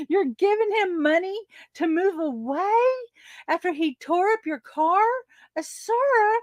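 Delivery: pitched high, disbelieving question; incredulous. Retold or imitated. Challenging her friend over paying the tenant, incredulous. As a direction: ask it as if you can't believe your ears.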